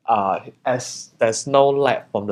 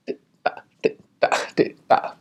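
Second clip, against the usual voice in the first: whispered tick-tack; slower than usual. Retold or imitated. Describing rain on the balcony to viewers, whispered tick-tack. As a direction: tap out the raindrops in a whisper.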